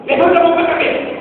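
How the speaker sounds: high, fast mock police command; barked police order. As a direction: snap the order like a cop at a stop. Policial mandando levantar as mãos. (ordem de policial, alta e rápida)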